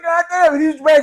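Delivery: high, loud amazed exclaim; imitating Amp. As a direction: blurt it like the impressed roommate. Amp impressed.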